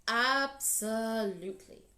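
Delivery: loud, slow, grand lion voice; a proud lion boasting, drawn out; big proud lion. Acted. Lion boasts to Anansi about his lunch, loud and grand. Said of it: "said Lion".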